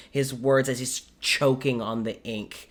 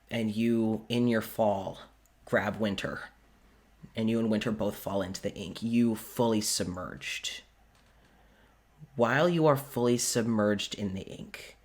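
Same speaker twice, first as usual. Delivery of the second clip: ordinary talk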